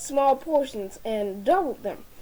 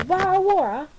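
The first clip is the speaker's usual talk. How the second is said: mock British accent, higher and swallowed; 6 semitones higher, 4 dB louder, faster than usual. Shown as a demonstration. Speaker shows off a practised British accent to royal guests, mock British. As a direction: put on a British accent with dropped consonants in "Bottle of water". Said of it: "in my British accent".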